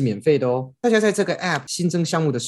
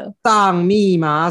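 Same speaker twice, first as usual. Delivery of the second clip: slow, drawn-out coaxing voice; teasing; 6 semitones higher, 6 dB louder, much slower than usual. Pretend. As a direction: coax slowly like to a child. Teasing clarification to co-host.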